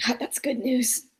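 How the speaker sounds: whispered, raspy relief; soft whisper from a damaged throat. Acted. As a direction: let it out as a hushed rasp. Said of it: "voice is kinda raspy".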